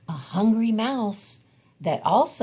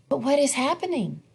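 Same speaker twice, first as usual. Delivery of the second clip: higher and quick, suddenly alarmed; alarmed question; 7 semitones higher, much faster than usual. A narrator's reading. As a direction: sound the alarm. Danger approaches the flower.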